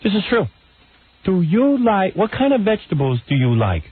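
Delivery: high-pitched mocking interviewer impression; Barbara Walters. Radio host mocking an interviewer to co-host, high imitation. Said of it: "this is how she talks".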